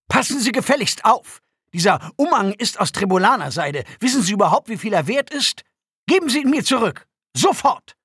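high, loud, haughty indignation; outraged demand. A narrator's reading. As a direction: demand your property back, building to a shouted order. Prisoner demands his cloak back. (empörte, hohe, laute Forderung)

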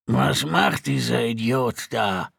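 slow, uneasy grumble in an altered voice; tense mutter. A narrator's reading. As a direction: mutter with uneasy disbelief, not really hushed. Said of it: "murmelte Kerlon". (angespanntes, langsames Murmeln)

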